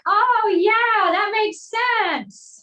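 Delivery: high, loud, drawn-out "oh yeah" of sudden understanding; mock realisation. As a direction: ham up a slow dawning aha, pitched high. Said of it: "you'll be like".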